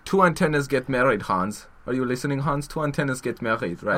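campy mock German accent; Hitler. Dictator telling his pal a joke, mock German accent. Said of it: "He was like".

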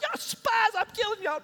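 high-pitched hammed-up accusation; playful high mock outrage; mock threat. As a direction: threaten them in a high, comic outburst. Joseph threatening brothers in mock accusation.

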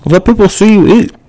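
high sweet imitation of mom; mom's voice. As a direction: coo it like a doting mother. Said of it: "She says".

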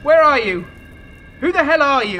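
high, loud frightened calls; scared shouting. Acted. Man scared by an unseen voice, shouting to find it. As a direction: shout into the dark.

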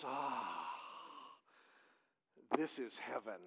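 soft, low sighing voice; contented sigh. Retold or imitated. Woman in a hammock at the seashore, sighing contentedly. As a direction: sigh it out low and hushed, imitating her. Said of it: "sighs, and she goes".

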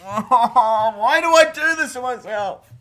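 high, loud mock despair; loud anguished groan. Real, not acted. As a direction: wail it in dread. Before eating relish.

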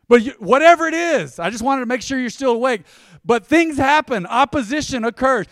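loud, high preacher's call; fiery preaching. Acted. Pastor rousing the congregation, loud preaching.

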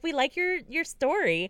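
high, chirpy editor voice; mock editor voice. Editors praising her story, high and chirpy.

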